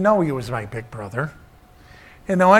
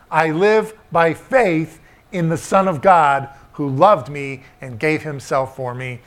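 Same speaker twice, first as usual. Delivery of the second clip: louder, slow, weighty scripture reading; preaching scripture; 2 semitones higher, 5 dB louder than usual. Acted. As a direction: proclaim the verse to the church.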